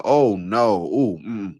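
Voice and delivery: imitating a cringe at his own recording; drawn-out mock-horrified "oh no"; mock dismay. Hearing his own voice on the answer machine, mock dismay.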